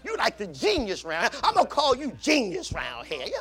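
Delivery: butter up the boss; flattering the boss. Retold or imitated. Worker flattering boss in hallway, soft playful. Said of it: "you say".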